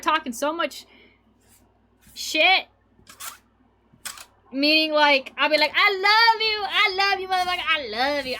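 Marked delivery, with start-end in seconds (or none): 5.8-8.4 gushing, sloppy affection; drunk lovey gushing. Retold or imitated. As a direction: gush it like a happy drunk. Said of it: "I get very lovey".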